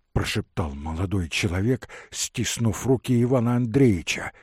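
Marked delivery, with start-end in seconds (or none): none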